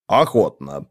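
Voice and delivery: low, satisfied one-word grunt; довольное кряканье. A narrator's reading. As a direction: accept gladly, pitched low. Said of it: "крякнул Ааро". (довольно крякнуть)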